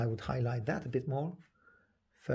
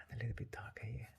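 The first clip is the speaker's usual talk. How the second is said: whisper; 10 dB quieter, much faster than usual. Real, not acted. Painter murmurs while working brush.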